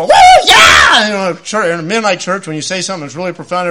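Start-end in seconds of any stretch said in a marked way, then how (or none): 0.0-1.1 high, loud whoop of praise; charismatic shout. Retold or imitated. Mocking charismatic worshippers with a loud high whoop. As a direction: whoop it up like a lively congregation. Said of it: "people in charismatic church go".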